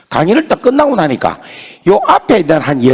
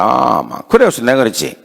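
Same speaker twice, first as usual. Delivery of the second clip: ordinary talk, nothing marked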